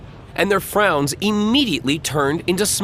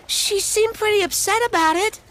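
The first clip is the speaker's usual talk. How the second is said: put-on much higher eager kid voice; little boy voice. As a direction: tell it like a proud little boy.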